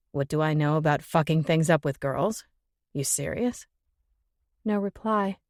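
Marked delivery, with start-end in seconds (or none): 0.1-3.7 male voice. Acted. Jimmy scoffing at Ben.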